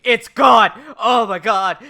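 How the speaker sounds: loud, excited mock outburst; hammed-up cry of amazement; retold shocked shout. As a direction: shout it like you can't believe it. Retold character exclaiming in shock.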